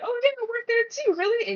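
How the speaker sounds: mock suspicious coworker. Coworker catching a stranger's fake job claim, mock suspicious. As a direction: call out the bluff.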